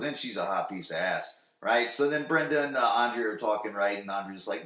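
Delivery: usual speaking voice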